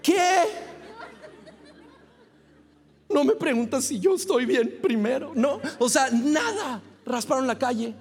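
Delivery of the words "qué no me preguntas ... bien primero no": outraged disbelief. Retold or imitated. Outraged at police fine.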